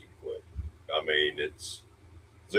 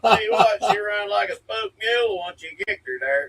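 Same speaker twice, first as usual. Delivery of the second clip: high, loud laughing yokel; 12 semitones higher, 6 dB louder than usual. Pretend. Mock interview, laughing hick voice.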